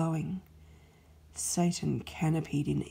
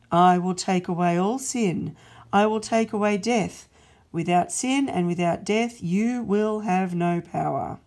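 louder, firm resolve, each I will stressed; God's loud proclamation; 8 dB louder than usual. Acted. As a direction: announce the plan like a decree. God declares victory.

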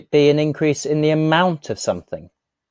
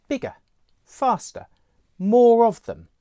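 growing excitement; 9 semitones higher than usual. Shown as a demonstration. Teacher acting out things getting bigger. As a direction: build up like something swelling. Listing ways things grow.

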